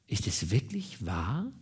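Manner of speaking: slow, soft question rising at the end; quiet, drawn-out wondering; hushed disbelief. A narrator's reading. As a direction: ask softly, scarcely believing. Woman doubts, taken aback by confession.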